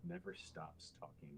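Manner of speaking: words blended into one stream; legato. Shown as a demonstration. Coach demonstrating legato for a student, smoothly connected delivery. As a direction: run it together softly, never stopping. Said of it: "if you go talk legato".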